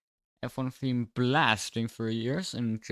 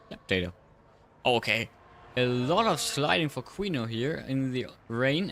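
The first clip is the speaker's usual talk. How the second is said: race commentary; 2 semitones higher than usual. Acted. Live race play-by-play.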